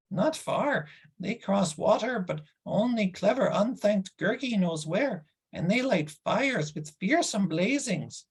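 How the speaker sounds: a sulky beast complaining about no food; whining creature Gurgi. Acted. Narrator voices Gurgi.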